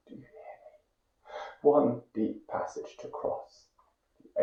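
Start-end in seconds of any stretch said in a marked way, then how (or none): 0.1-1.3 hushed, much softer whisper; whispered refrain of a poem. Acted. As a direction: barely breathe the refrain.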